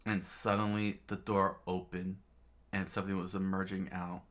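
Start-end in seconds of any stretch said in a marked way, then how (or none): none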